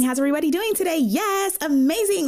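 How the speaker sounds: very high, gushing office act; masking work voice. Retold or imitated. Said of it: "Masking was you going up in there".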